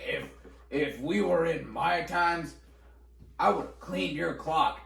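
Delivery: slow, deliberate threat in an imitated teacher's voice; menacing old-school teacher. Wisconsin teacher threatening a fourth grader, slow mock menace. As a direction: draw out the threat slowly, word by word.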